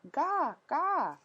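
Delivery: put-on high fussy baby babble; crying baby babble. A narrator's reading. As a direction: whine it like a fretful baby. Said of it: "He began to cry".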